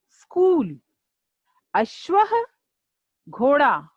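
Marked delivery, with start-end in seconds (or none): 1.7-2.4 पाठः called out high with the final breath of the visarga; Sanskrit recitation. Shown as a demonstration. Teacher models Sanskrit word for pupils, called out high. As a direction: model पाठः high and clear for the class to echo. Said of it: "जैसे जैसे उच्चारण किया है".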